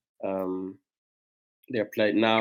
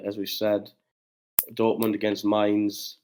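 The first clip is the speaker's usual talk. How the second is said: ordinary delivery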